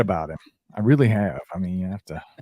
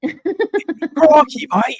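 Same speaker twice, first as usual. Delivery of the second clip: Crikey, mate in a much higher put-on Australian voice; Australian accent; 4 dB louder, much slower than usual. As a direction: squeak it out like a cartoon Aussie. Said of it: "Go, "Crikey".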